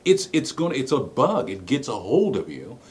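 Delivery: ordinary talk, nothing marked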